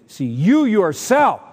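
"you yourself" high, loud and slow; loud, insistent weight on "you yourself"; total emphasis. Shown as a demonstration. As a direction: hammer "you yourself", stretching it out. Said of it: "that's total emphasis".

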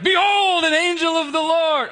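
hammed-up angelic proclamation with "Behold" and "an angel of the Lord" both shouted high; booming angel. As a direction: announce yourself like a heavenly herald. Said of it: "he appears like".

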